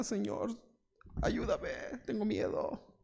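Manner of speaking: soft, slow mock fearful prayer; a touch of fear, quiet and slow; frightened plea. Luther in the thunderstorm crying to God, softly frightened. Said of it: "con sus sustos".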